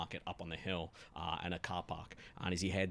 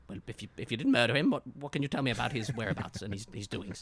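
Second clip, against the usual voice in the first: fast, rattled questioning; nervous detective; 2 semitones higher, faster than usual. Acted. Detective shrinking from a looming suspect, fast and rattled. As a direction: hurry the words out, intimidated. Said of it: "He kinda shrinks away... he says".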